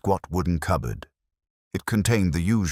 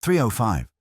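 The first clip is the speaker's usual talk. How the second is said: high-pitched, puzzled repeat; surprised echo; 6 semitones higher than usual. A narrator's reading. Reverend taken aback by inspector's question, surprised.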